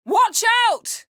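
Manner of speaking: high, loud sudden warning yell; piercing cry of alarm; warning shout. Acted. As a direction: scream a warning at a friend.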